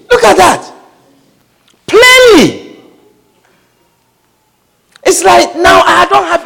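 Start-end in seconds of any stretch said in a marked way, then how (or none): 0.1-2.8 Plainly said high and drawn out; stress on Plainly. Real, not acted. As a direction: land hard on Plainly, higher.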